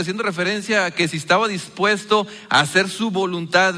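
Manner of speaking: ordinary talk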